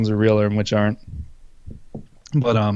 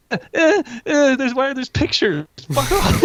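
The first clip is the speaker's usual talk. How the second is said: freaked-out yelp; 14 semitones higher than usual. Real, not acted. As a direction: recoil as the scary pictures pop up. Startled by phobia pictures.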